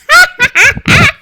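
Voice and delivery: high, loud trailing laugh. Acted. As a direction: let the laugh ring out. Spirit laughing mid-line.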